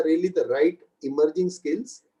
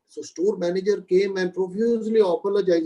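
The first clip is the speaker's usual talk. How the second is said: ordinary delivery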